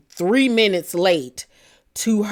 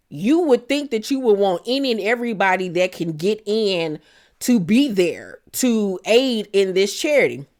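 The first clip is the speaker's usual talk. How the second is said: ordinary talk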